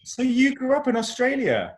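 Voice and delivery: high, loud astonished question; real amazement. Real, not acted. Singer learning fan grew up in Australia, amazed. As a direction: ask it in sheer surprise.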